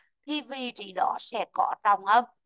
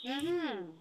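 ordinary talk

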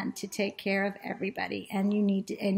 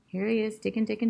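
plain reading voice

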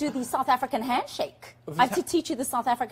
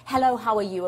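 usual speaking voice

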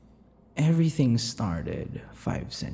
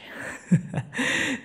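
breathy giggle. Acted. Giggles mid-sentence.

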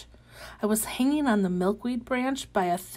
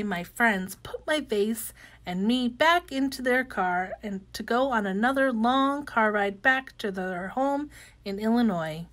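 ordinary delivery

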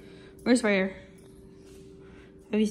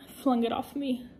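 ordinary delivery

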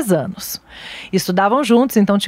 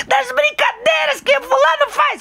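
put-on very high shouted kid voice; child's answer; 14 semitones higher than usual. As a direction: blurt it like a relieved little boy. Filho responde à mãe. (grito agudo de criança)

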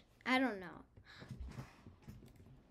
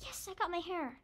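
usual speaking voice

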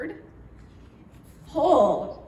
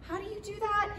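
higher, eager question; lightly voiced curious boy; 11 semitones higher, faster than usual. Cody asking Ashley to teach him the craft, higher eager voice. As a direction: ask it like an eager kid learning. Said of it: "So he'd be like".

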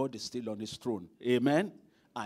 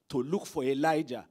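ordinary talk

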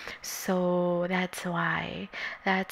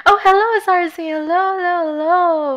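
excited greeting; 8 semitones higher, 9 dB louder than usual. Real, not acted. Greeting an arriving viewer.

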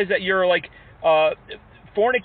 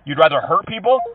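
normal talking voice

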